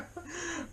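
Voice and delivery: high breathy burst of laughter; real giggle fit; helpless laugh. Real, not acted. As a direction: crack up uncontrollably. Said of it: "why I'm laughing so hard".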